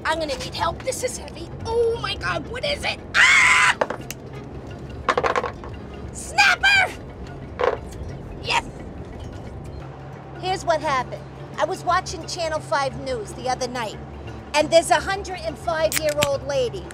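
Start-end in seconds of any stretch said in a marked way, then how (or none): none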